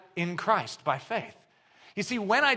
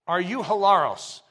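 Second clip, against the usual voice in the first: usual speaking voice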